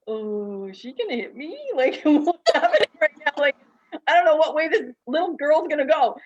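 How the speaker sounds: high, loud, half-laughing imitation of a startled man; laughing as you play the startled practitioner; nervous man. Retelling how Daniel reacted, a high laughing startled voice. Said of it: "he was like".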